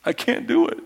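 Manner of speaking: strangled, breathless whisper; choked whisper. Acted. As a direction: squeeze the words out as if choked. Choked servant gasps reply.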